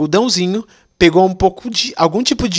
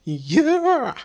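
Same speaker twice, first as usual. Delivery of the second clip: diva yeah; 9 semitones higher, 6 dB quieter than usual. Retold or imitated.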